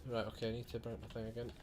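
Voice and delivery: fast, soft mutter under the breath; quick muttering to self. Real, not acted. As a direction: rattle it off quietly to yourself. Muttering to himself while playing.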